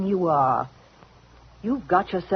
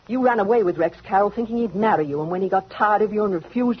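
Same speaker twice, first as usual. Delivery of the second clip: ordinary delivery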